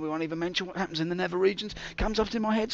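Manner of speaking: normal talking voice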